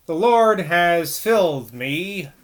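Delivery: slow, rumbling preacher's voice; hoarse rumble. A narrator's reading. Bloody Johnny rumbling his sermon to Decker, slow and hoarse. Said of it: "again in his hoarse voice".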